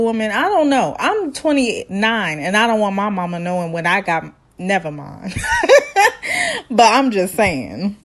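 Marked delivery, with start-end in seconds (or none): 5.4-6.7 high-pitched burst of laughter. Real, not acted. Friend breaks off her own confession, high laughing. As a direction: laugh at your own remark.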